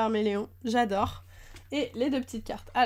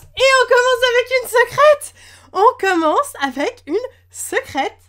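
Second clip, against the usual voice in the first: shrieking with joy, much higher and louder; excited scream; 12 semitones higher, 14 dB louder than usual. Real, not acted. Pulling a secret rare card, screams to viewers. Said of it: "je suis désolée, j'ai crié bien trop fort".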